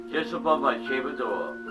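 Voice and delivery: plain reading voice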